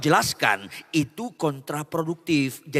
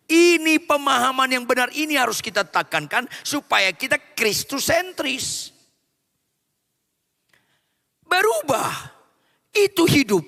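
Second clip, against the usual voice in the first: sermon climax, voice raised high; full-force preaching; 11 semitones higher, 5 dB louder than usual. Acted. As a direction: lift the congregation with it. Preacher stressing key doctrine.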